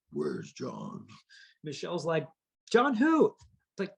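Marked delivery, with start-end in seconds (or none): 0.1-1.1 a deep, quiet, threatening father's voice; a growled demand from the doorway; dad bursting in, low and menacing. Retold or imitated. 2.7-3.3 put-on high, sing-song innocent girlfriend; fake innocence.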